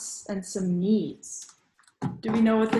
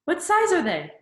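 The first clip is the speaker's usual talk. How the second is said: excited grandma; 9 semitones higher, 4 dB louder than usual. Acted. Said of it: "Grandma says".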